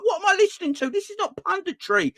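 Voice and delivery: high, strained disbelief; shrill outrage at what he hears; incredulous rant. Retold or imitated. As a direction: viewer fuming at the TV pundits. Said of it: "I'm like".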